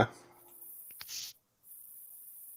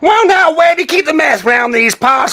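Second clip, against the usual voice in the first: loud, raised-pitch backwoods Southern holler; raw Southern accent; 7 dB louder than usual. Shown as a demonstration. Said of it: "I'll do a Southern raw".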